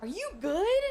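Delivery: questioning lilt, rising at the end; retold check-in with a pointed rise; mock concern. Streamer retelling her reply to an angry player, mock-concerned.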